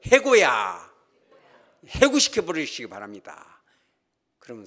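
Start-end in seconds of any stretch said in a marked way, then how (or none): 0.1-0.9 shouted firing line; loud repeat. Shown as a demonstration. Preacher leading the congregation in a loud chant. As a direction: belt it out for the congregation to echo. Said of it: "우리 따라서 하죠".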